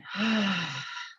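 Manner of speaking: long quiet letting-go sigh; big sigh. Shown as a demonstration. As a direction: sigh it out gently. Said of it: "and a big sigh".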